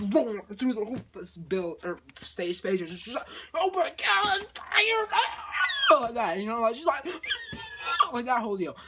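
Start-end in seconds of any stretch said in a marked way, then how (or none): 3.5-6.0 high, loud shriek; hammed-up terror ending in a screech; mock panicked scream. She finds flames in the space station, mock scream. Astronaut discovers fire. 7.1-8.1 piercing high shriek; hammed-up screech; mock scream. She flees the rolling flames, mock scream.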